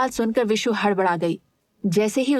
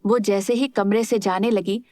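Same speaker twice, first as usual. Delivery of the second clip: usual speaking voice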